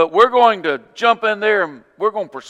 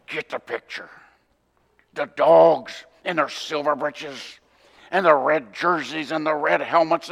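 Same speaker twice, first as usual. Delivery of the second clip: gravelly Larry Munson. Acted. Preacher mimics a football announcer for his listeners, very gravelly voice. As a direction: growl out the play-by-play scene in a gravelly voice. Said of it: "in a very gravelly voice".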